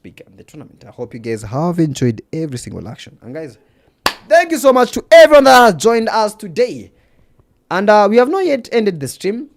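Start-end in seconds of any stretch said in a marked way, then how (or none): 4.3-9.5 much louder, higher hype-host delivery; loud, high thanks. Real, not acted. Streamer thanking everyone who joined today, loud and excited. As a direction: belt out a big, high thank-you to the crowd.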